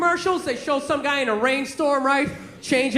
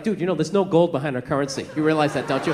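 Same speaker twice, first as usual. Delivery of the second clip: low, monotone true-believer voice; conspiracy theory guy; 11 semitones lower, faster than usual. Acted. Conspiracy guy cornering a friend during the game, low and intense. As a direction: drop low, confiding a grim secret. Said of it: "That conspiracy theory guy".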